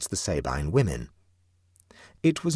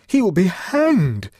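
much higher, louder, slow certain cry; exclaimed with conviction; 12 semitones higher, 9 dB louder, slower than usual. A narrator's reading. Huddle to Clovis, a loud convinced cry. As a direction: declare it loud and high. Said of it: "exclaimed with conviction".

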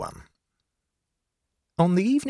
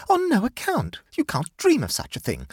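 much higher, urgent protest; exclaiming in alarm; 14 semitones higher than usual. A narrator's reading. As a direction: jump in high and alarmed to stop him. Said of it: "exclaimed Amanda".

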